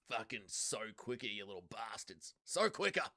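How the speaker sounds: a lightly voiced evil factory boss, low and soft; mock sinister boss hurrying the workers. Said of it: "Impression of evil Dougie running the factory".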